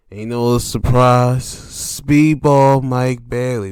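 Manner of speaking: loud, slow hype call; booming reveal; hype announce. Real, not acted. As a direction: sell the surprise like a ring announcer. Hyping a surprise entrant.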